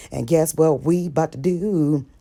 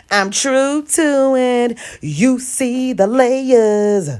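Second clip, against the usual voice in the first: sassy; 6 semitones higher, much slower than usual. Pretend. Slow showy catchphrase.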